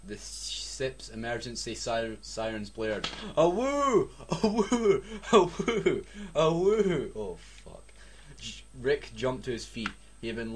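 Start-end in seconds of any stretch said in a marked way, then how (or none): 3.4-7.1 loud high wailing siren "a woo"; alarm siren imitation. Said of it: "Went the alarm".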